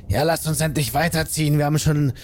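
lightly put-on character voice, impatient; lightly voiced companion urging the group onward; Gundren. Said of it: "Und Gundren". (leicht verstellte Stimme, drängt zum Aufbruch)

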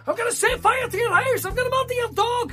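very high, screechy crowd heckling; mock enraged heckler. Comedian acting a docile man turned rabid heckler, mock fury. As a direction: scream abuse like a crazed audience member. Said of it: "a relatively docile fella".

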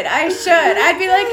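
ordinary talk, nothing marked

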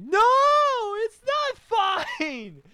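very high, loud bawling protest; mock tearful wail. Crying boy refusing girls' comfort, hammed-up wail. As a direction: wail it like an inconsolable kid. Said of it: "Hey, stop crying".

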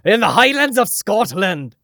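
put-on second, bolder go at a joking Scottish accent; big, loud, exaggerated Scottish brogue; mock Scottish accent. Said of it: "There you go".

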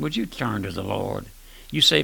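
ordinary talk

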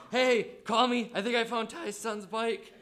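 acted-out winded, urgent phone message; winded voicemail. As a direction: call out frantically, as if mid-run. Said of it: "winded voicemails to mutual friends".

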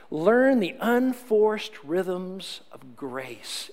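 unhurried, loving read; savoured phrase. Shown as a demonstration. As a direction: linger over every word. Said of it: "I love this phrase".

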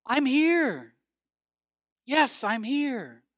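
high, calling answer from above. Acted. The Lord answering a man on a cliff, calling voice from above. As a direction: call back down, drawing the words out. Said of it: "There was a voice that responded".

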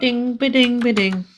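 sung ding jingle, high; coin chime sound. Pretend. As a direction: chime it out like a bell. Podcast outro jingle sung.

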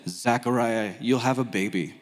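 low, measured angelic announcement. Retold or imitated. Angel announces a baby to Zechariah, low solemn voice. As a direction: drop low to deliver heavenly news. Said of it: "an angel, boom, appears out of nowhere and says".

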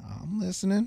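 comic high-pitched I'm listening; mock eager. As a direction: perk right up at the money. Punchline reply to the artist's pitch.

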